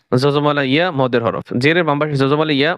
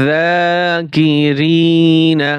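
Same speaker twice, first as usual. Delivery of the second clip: slow drawn-out reading; 2 semitones higher, much slower than usual. Shown as a demonstration. Teacher to students, showing how to blend the syllables, slow chanted reading. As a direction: stretch every syllable, very slow and sung out. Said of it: "বানান করে পড়বেন".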